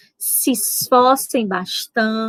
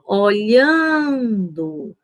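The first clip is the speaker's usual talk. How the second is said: Olhando stretched slowly on a long LHAN; slow listening demo, o-LHAAAN-do; stress on middle syllable; much slower than usual. Shown as a demonstration. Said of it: "se concentra na palavra".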